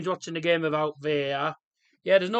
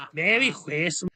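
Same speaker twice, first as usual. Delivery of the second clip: slow, hammed-up Scottish; bad Scottish accent; 5 dB louder than usual. Streamer repeating his Christmas greeting in Scottish, bad Scottish accent. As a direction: stretch it out in a poor Scottish impression. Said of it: "it's such a bad Scottish".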